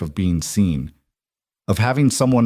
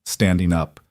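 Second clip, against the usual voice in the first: plain reading voice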